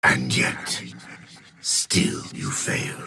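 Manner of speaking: a hushed, breathy taunt; low menacing hiss. Acted. Warlord hissing at the stubborn human, a low whispered taunt. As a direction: let it slip out under the breath, cold.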